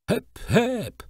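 high, thin calls to the horse; a little girl's high calls from the horse's back. A narrator's reading. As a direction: cry out in a tiny girl's voice. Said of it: "pokrzykiwała cienkim głosikiem Jenny".

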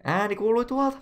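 high, loud character call; alerted searcher voice. Acted. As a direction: call out the discovery in a high put-on voice. Searcher calls out hearing a sound.

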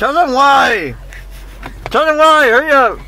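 loud, high yell; shouting. Real, not acted. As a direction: yell it out urgently. Shouting at partner to explain.

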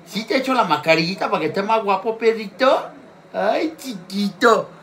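high cooing pet voice; doting talk to a little dog. Real, not acted. Talking to her dog during mascarilla.

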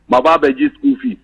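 ordinary delivery

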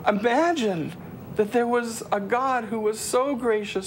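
usual speaking voice

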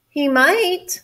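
a touch of a sigh; sighing squirrel. A narrator's reading. Squirrel agreeing with the sad cat, lightly sighing. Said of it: "sighed the squirrel".

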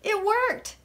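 high, lilting little cheer; joyful singsong. A narrator's reading. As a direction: sing it out, pleased. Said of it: "Tulip sang the next morning".

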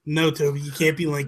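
normal talking voice

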